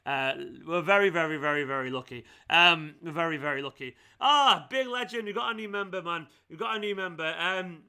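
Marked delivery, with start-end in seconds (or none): none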